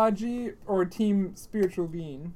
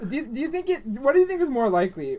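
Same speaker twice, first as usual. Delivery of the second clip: ordinary talk, nothing marked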